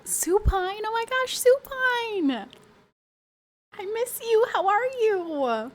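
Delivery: high, loud squealing greeting; thrilled to see a friend; excited. Real, not acted. Excited greeting to a returning viewer.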